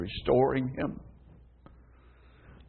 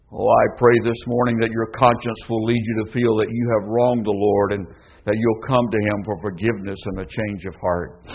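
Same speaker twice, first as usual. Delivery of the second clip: ordinary delivery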